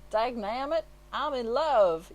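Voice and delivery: a cowboy blurting out he's smitten; cowboy from a horse commercial, loud and surprised; lovestruck cowboy. Retold or imitated. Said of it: "there's a guy riding a horse".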